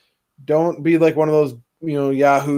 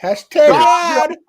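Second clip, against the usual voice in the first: much higher, slow sly aside; mock announcer; 11 semitones higher, 5 dB louder, much slower than usual. Real, not acted. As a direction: wink at the sponsor. Mocking sponsored plug.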